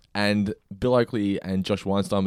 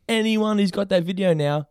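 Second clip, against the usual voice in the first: high-pitched mock announcement; studio enforcer; 11 semitones higher than usual. Studio ordering the video taken down, high mock announcement. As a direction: call it out as a sweeping order.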